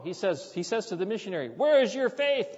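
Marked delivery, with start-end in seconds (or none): 1.5-2.5 high, loud reproach; challenging villager. Retold or imitated. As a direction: throw the question back at him, much higher.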